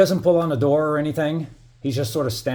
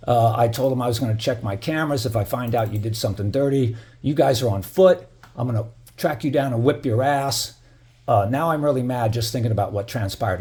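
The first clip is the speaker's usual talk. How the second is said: ordinary talk, nothing marked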